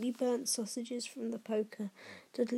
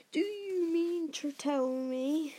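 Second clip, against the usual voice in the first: slow, higher growl; angry growl; 5 semitones higher, slower than usual. A narrator's reading. Hagrid confronting the Dursleys, growling. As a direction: growl it slowly, menacingly. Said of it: "he growled at the Dursleys".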